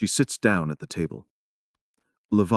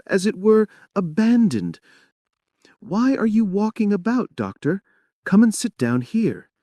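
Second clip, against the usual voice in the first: higher-pitched lamenting wife; Anna, lonely and plaintive; 9 semitones higher than usual. Acted. Lonely wife talking to the doctor, plaintive. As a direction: feel left out and forlorn.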